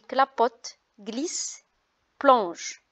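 "clapote, glisse, plonge" ending on the consonant with the ENT silent; correct pronunciation. Shown as a demonstration. Teacher repeating the right form for pupils, ENT silent. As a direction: clip "glisse" with no ending sounded. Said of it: "on dit".

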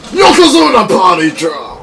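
big hyped call-out; announcer shout. Pretend. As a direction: bellow it like a wrestling announcer. Wrestling move called out in game.

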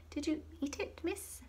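high, soft, innocent-sounding question; polite little girl. A narrator's reading. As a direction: ask it sweetly, all innocence. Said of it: "inquired the girl politely".

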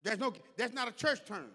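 loud, high, emphatic sermon delivery; full-force preaching. Real, not acted. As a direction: drive each phrase home to the congregation.

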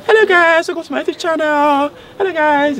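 squeaky high mock foreign accent, loud; mock posh YouTuber intro; fake foreign YouTuber accent. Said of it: "They're like".

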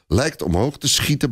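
plain reading voice

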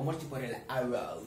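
ordinary delivery

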